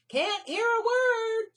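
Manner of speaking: slow, high, loud scream over the noise. Acted. Melissa screaming over the traffic noise. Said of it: "screamed Melissa".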